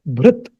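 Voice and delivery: bhrit with no final a; correct pronunciation. Shown as a demonstration. Teacher stresses required form, bhrit ending on t. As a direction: close bhrit on the bare t. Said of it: "This has to be pronounced as".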